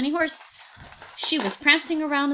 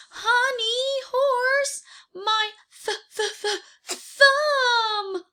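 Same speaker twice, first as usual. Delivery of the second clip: much higher, slow stammering whimper; hurt crying tiger; 13 semitones higher, 4 dB louder, much slower than usual. Acted. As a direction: wail about a squashed thumb. Tiger's thumb caught in door.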